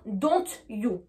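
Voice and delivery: DON'T YOU as two words, sounded T then "you"; French pronunciation. Shown as a demonstration. Teacher showing the French habit, DON'T YOU unlinked. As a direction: keep DON'T and YOU apart, French-style. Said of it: "avec la prononciation française".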